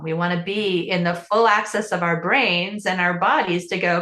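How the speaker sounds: ordinary delivery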